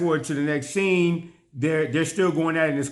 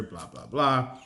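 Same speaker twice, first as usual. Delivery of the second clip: ordinary talk, nothing marked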